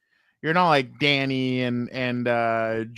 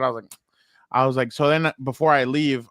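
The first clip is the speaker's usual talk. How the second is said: ordinary talk, nothing marked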